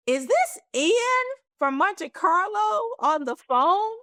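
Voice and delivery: high, slow, stunned question; can't believe who's calling; startled recognition. Retold or imitated.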